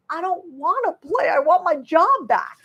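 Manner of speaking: put-on high, slow childish whine; sulky child. Answering husband's pep talk, high, slow childish whine. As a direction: pout it like a kid refusing to play. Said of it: "And I was like".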